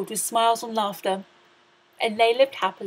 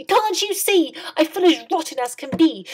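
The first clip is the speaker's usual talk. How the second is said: high, loud whiny complaint; Cindy complains loudly to the fairy; complaining cry; 7 semitones higher, 6 dB louder than usual. A narrator's reading. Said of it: "cried Cindy".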